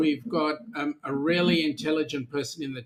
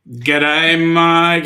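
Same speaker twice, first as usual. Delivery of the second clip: loud greeting; 9 dB louder than usual. Real, not acted. Loud greeting to chat member.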